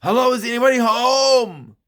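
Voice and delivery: calling out loud into the house. Retold or imitated. Bobby calls loudly into the empty house. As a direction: call out into the dark doorway. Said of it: "in a loud voice".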